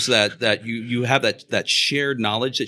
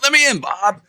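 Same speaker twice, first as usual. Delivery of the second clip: high, loud mock begging; acted impatient shout to a friend; exasperated plea; 11 semitones higher, 5 dB louder than usual. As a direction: call through the door to be let in. Acted visitor begging entry.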